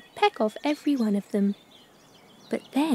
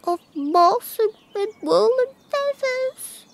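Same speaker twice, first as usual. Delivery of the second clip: tearful; 12 semitones higher, 4 dB louder than usual. Acted. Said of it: "she told Scarecrow tearfully".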